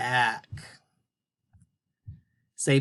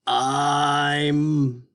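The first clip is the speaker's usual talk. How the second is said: puppet sounding out; 4 dB louder than usual. Pretend. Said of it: "Can you say that again?".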